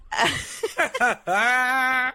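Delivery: high-pitched peal of laughter; real laugh. Real, not acted. After the not uplifting murder story, laughing it off. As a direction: laugh off the grim subject.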